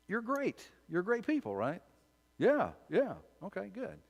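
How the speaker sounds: high-pitched, sarcastic praise; Joshua, sarcastic. Acted. Joshua humouring tribes who think they are great, sarcastic. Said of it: "I love Joshua's sarcasm".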